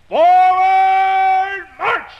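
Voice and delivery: long, loud, high drawn-out order; loud command. Acted. Scout leader orders the parade forward, loud. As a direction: send the troop off marching. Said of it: "came the command".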